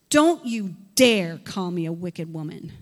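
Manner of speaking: loud, defiant retort. Acted. Hannah answers Eli, loud and defiant. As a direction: push back hard. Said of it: "look at her response".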